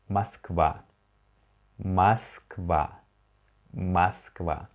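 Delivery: Moskva read slowly as MASK-VA, unstressed O as A; Russian word read out. Shown as a demonstration. Teacher models Moskva for students to repeat, slow and clear. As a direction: say Moskva with stress on the last syllable, O like A. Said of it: "the first word, sorry, is".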